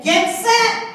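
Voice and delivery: ready the runners with a sharp call; history as the race starter calling out; starter's call. Acted. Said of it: "The Reconstruction period said".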